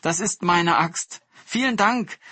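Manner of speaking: overjoyed woodcutter calling out thanks; joyful cry. A narrator's reading. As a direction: burst out with happy relief. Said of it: "rief Chadol und freute sich".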